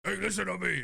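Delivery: pestering movie tough-guy voice; rising insistence, pitch climbing; Al Pacino. Acted. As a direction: demand attention in a hammy impression. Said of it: "If he's gonna be Al".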